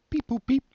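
high, loud robot beeping; robot beeps. Pretend. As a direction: chirp it like a little robot. Robot noise blurted out.

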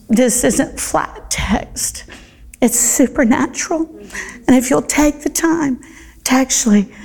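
high, rising full-force preaching; impassioned sermon climax; passionate preaching. Real, not acted. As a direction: lift them with the ending.

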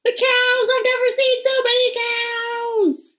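high, hammed-up cry of amazement; play-acted excited yelling; mock shouting. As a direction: wail it out in pretend astonishment. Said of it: "but you're shouting".

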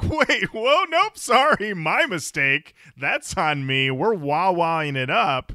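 mock surprise. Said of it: "I'm like".

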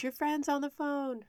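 put-on high, drawn-out call of an older woman; calling out excitedly. Kind lady calling her over with good news. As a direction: sing it out like someone running up with news.

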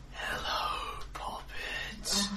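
breathy, hushed and airless; breathless voice. Acted. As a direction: breathe the words out softly like a starlet. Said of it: "in a very breathless voice that still sounds vaguely like Septimus".

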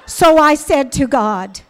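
usual speaking voice

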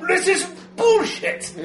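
loud, slow, high-pitched outburst; indignant blowhard roaring in protest; rumbling outraged bellow. Acted. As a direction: bellow it over the crowd. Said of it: "You hear the rumbling voice".

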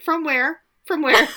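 high, loud baffled demand; incredulous mocking. Real, not acted. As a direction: press the question in mock outrage. Mocking the melodramatic diary lines.

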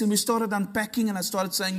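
ordinary delivery

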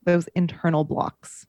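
ordinary talk, nothing marked

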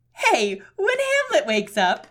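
loud, high cartoon-villain character voice; Horatio voice. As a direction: pitch it high and project it loudly. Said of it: "I'm doing a Horatio voice".

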